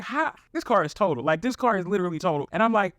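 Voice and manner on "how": high, shocked how; shocked at the dented car; disbelief. Retold or imitated. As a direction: squeak out disbelief.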